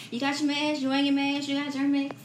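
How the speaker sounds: quick caring checklist, half-imitated; voicing worried mother. Mother fussing over safety precautions.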